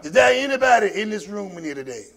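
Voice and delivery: ordinary talk, nothing marked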